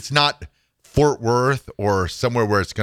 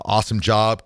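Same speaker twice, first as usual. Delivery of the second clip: normal talking voice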